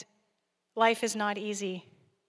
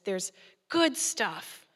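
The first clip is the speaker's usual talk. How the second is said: ordinary delivery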